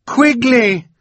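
high, loud desperate scream; screaming a name. A narrator's reading. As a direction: scream after him as he drifts. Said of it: "Violet screamed again".

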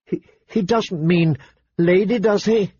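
put-on a stammering girl's fear; frightened. A narrator's reading. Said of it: "Her eyes were frightened".

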